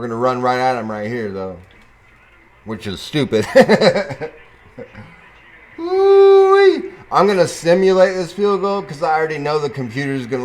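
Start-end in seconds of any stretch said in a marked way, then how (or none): 3.5-4.3 quick high chuckle; amused chuckle. Real, not acted. Laughs at his stupid play. 5.8-6.9 long loud high "woo-wee"; high celebratory whoop. Real, not acted. Celebrates a good play.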